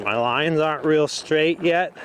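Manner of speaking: normal talking voice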